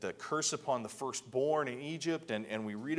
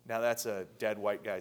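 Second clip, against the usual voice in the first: ordinary talk, nothing marked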